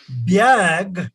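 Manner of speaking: BAG said slowly as "byag"; local pronunciation of BAG. Shown as a demonstration. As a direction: slip a y-glide into BAG, "byag". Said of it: "we say".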